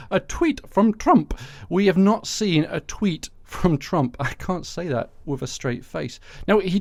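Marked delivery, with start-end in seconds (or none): 0.1-4.2 slow, careful "a tweet from Trump"; tongue twister. Shown as a demonstration. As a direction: work through the tongue twister slowly. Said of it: "That is quite hard to say".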